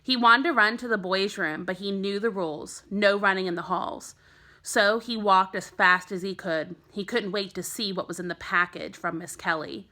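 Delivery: ordinary delivery, nothing marked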